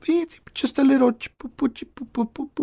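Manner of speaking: tiny high-pitched nonsense babble; cutesy baby talk. Pretend. Offering a little piece of information, cutesy high babble. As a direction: coo it high and small, like to a baby.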